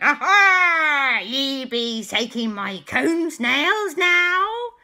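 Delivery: loud, high, drawn-out pirate cry; pirate captain. Acted. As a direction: roar it like a storybook pirate. Pirate captain confronts boys.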